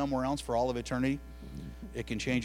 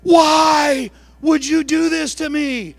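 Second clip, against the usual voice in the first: put-on slow, loud, high wail of a hurt child; hurt child's cry; 13 semitones higher, 15 dB louder, much slower than usual. Mom voicing her child's stunned protest, loud slow wail. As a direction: stretch it out like a wounded kid.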